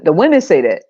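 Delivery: normal talking voice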